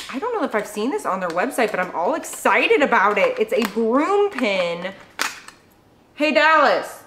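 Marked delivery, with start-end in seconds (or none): 6.1-7.0 raised, higher calling voice; calling someone over. Real, not acted. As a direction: call out to someone in another room. Calls someone over from elsewhere.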